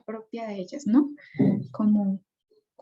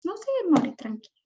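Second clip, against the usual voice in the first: much higher, coaxing indulgent parent voice; permissive parent; 6 semitones higher, 4 dB quieter than usual. Retold or imitated. Preacher mimics a permissive parent letting the child go, high indulgent voice. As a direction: play the pushover mum waving the kid off, pitched way up. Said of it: "Y el permisivo".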